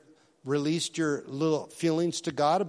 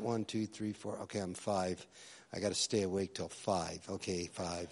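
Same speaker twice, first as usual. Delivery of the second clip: sleepy, much softer and lower, fading off; drowsy counting; 9 semitones lower, 11 dB quieter than usual. Retold or imitated. Youth pastor dozing through early prayer, sleepy mumble. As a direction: count under your breath like you're nodding off.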